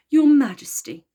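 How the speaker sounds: a proud horse announcing himself; grand. A narrator's reading. Pegasus greets the Queen with a bow, grandly. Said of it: "said Pegasus grandly".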